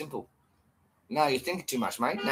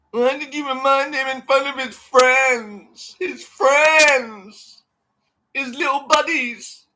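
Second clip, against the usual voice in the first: high, slow mock wailing; wailing whiny voice; 11 semitones higher, 5 dB louder, slower than usual. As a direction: wail like an offended fan.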